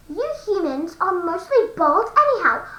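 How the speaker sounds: usual speaking voice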